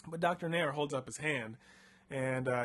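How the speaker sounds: normal talking voice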